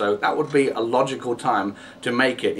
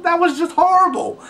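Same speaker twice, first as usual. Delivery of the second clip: much higher, louder shriek of disgust; horrified outburst; 4 dB louder than usual. Real, not acted. Vlogger recoiling from the scene, high disgusted shriek. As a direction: squeal it out in disbelief.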